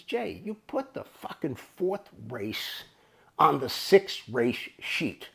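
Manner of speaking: slow, spaced-out words; retold exasperated reproach. Clerk confronts Jay over the wrong sheet, slow exasperated reproach. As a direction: spell out the blunder word by word.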